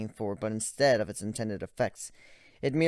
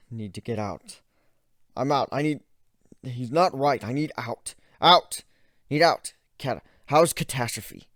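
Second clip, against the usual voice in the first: louder, broken panicked fragments; shaky panic; 3 semitones higher, 8 dB louder than usual. Acted. Ivan panicking and asking about Catastrophe. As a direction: stammer in frightened panic. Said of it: "His voice shook".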